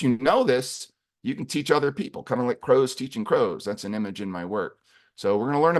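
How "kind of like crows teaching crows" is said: usual speaking voice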